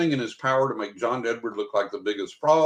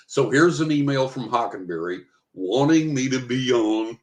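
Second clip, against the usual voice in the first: normal talking voice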